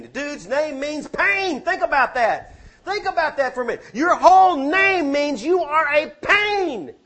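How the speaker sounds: high, loud, incredulous preacher's shout; full-force preaching. Real, not acted. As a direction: preach it loud and high, stretching key words.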